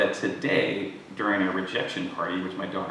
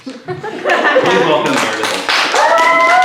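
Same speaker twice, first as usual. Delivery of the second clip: loud, slow introduction; announcing; 13 dB louder, much slower than usual. Real, not acted. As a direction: call the name out to the room. Introducing the speaker to applause.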